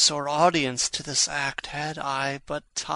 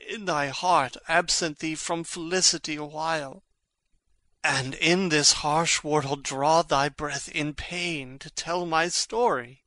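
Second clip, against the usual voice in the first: ordinary delivery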